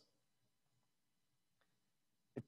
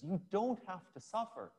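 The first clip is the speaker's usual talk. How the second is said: higher, pleading end of the outburst; urgent plea; 8 semitones higher than usual. Retold or imitated. Peter pleading with Jesus, higher and urgent. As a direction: beg him not to suffer. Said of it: "So he blurts out".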